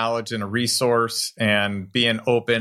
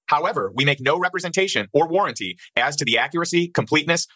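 rapid, slightly higher legal read; fast legal disclaimer; 4 semitones higher, faster than usual. Acted. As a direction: rattle through the fine print.

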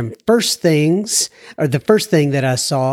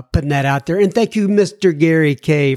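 usual speaking voice

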